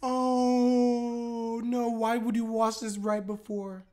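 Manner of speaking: long, high, drawn-out groan of protest; loud, despairing lament; wailing dismay. Real, not acted. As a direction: wail it slowly on a held high note. Dismayed wail at character's choice.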